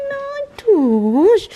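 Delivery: loud, high call to the pet; sharp loud call to the dog. Real, not acted. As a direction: call out to the puppy. Calling to the dog.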